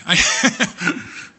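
short airy laugh; a breathy chuckle at the memory; real amused laugh. Real, not acted. Laughs at story.

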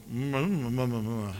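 low, closed-mouth hums in place of words; embarrassed mumble. Retold or imitated. Dodging her question, he mumbles the answer. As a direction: hum the answer so nobody can make it out. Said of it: "And I mumbled".

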